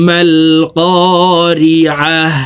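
slow, drawn "করিআহ" closing in a breath of h; pausal h ending. Shown as a demonstration. Teacher showing the stop on round ta, "করিআহ" closed with a silent-vowel h. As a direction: end "করিআহ" on a still, audible "হ". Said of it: "এইভাবে পড়তে হবে".

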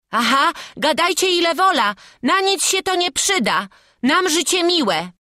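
put-on high, loud child's retort; high-pitched refusal; defiant boy. A narrator's reading. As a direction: scoff back as a cheeky lad. Boy defies the prisoner.

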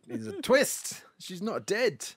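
high, loud burst of glee; gleeful twist reveal. Real, not acted. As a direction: sell the twist like a giddy fan.

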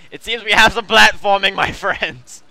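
loud announcement. Acted.

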